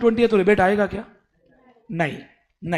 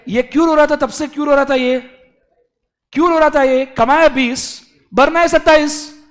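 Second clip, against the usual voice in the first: excited teacher; 6 semitones higher, 8 dB louder than usual. Real, not acted.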